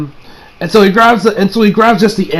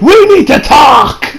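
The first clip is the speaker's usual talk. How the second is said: slow, loud, higher demand; stern shout; 8 semitones higher, 4 dB louder, slower than usual. Acted. Wife confronts husband in the shower, slow loud demand. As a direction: call him out firmly, word by word.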